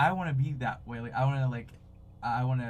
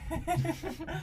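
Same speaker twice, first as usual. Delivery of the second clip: high giggly nervous laugh. Real, not acted. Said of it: "nervous laugh".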